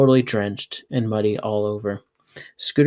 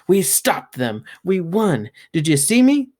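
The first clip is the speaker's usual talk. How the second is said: rising higher with each cry of triumph; a kid who just won; excited child; 7 semitones higher, 4 dB louder than usual. Acted. Child celebrating a victory.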